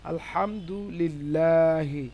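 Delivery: আলহামদুলিল্লাহি slow and measured, long light "laa"; thin Lam pronunciation. Shown as a demonstration. Teacher gives a thin example, আলহামদুলিল্লাহি slow with light Lam. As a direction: stretch আলহামদুলিল্লাহি with a thin open "lillaahi". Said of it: "পাতলা বা বারিক হয়".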